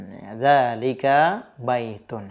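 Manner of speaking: teacher repeating the answer softly for learners; slow quiet repeat. Shown as a demonstration. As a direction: echo the answer gently and slowly. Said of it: "jawabannya apa yang tepat".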